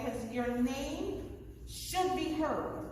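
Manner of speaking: each word slowly weighted; slow and deliberate. Real, not acted. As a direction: stress the point slowly. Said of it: "Project your voice".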